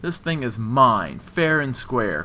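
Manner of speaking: loud, slow, possessive growl. A narrator's reading. Man refusing to hand over the ring, angry growl. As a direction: growl it out, each word drawn out.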